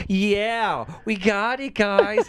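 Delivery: high, drawn-out mock cheer; corny hyped girl. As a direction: imitate a corny fan celebrating.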